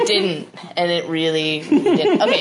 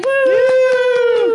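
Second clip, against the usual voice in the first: excited cheer; 6 dB louder than usual. Real, not acted. Cheering Casey's wedding.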